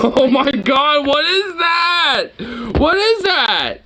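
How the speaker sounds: seeing the friend's sad burger on camera, shouting in shock. Real, not acted. Drag each word out in horrified surprise.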